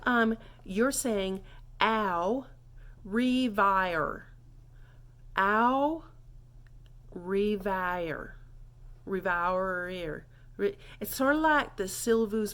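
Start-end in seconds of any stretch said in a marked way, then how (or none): 1.8-10.3 "au", long pause, "revoir" as ree-vye-er; rolled vowel demo. Shown as a demonstration. Teacher teaching goodbye, slow word-by-word demo. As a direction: split "au revoir", drawing out each part. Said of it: "You gotta really let that, the O and the I roll".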